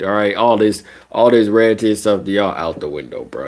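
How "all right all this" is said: normal talking voice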